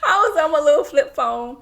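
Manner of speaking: giggling and squealing through the line; laughing. Real, not acted. Cracking up recalling flip phone.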